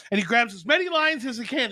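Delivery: normal talking voice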